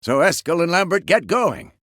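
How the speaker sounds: mock her brisk list of commands; indignant impression of someone giving orders; imitating a bossy woman. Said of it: "jumps right into a".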